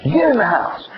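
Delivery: mock screaming father. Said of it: "I just start screaming at him".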